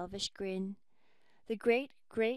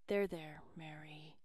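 soft, lower comfort fading to a whisper; soothing; 6 semitones lower, 8 dB quieter than usual. Acted. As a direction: hush her gently. Husband comforts weeping wife.

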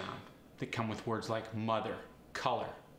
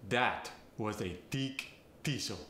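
thick thistle said tick tistle; Farsi accent. Shown as a demonstration. Coach demonstrating a Farsi accent, a tick tistle. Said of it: "The voiceless T H gets replaced with a T".

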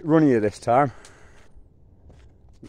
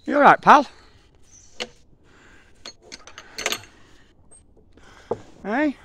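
high pet-talk voice; 13 semitones higher, 4 dB louder than usual. Real, not acted. Calling to horse, high pitch.

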